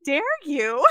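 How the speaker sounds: mock indignant at the kids, laughing; mock outrage. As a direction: drag it out in hammed-up indignation. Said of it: "and I'm like".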